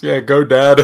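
loud, high cheer; cheering on dad. Retold or imitated. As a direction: cheer him on like a proud son. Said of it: "specifically calls out to Zeus".

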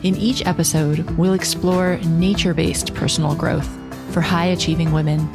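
measured, low-key intro narration; podcast intro read. Acted. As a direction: read it like a show opener. Scripted podcast intro over music.

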